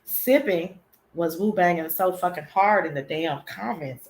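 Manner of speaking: ordinary talk, nothing marked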